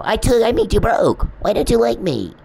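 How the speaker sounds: high, loud mock whine of a needy man; exaggerated high imitation, self-pitying and loud; mocking the stalker. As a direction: whine it like a pouting creep who ruined her.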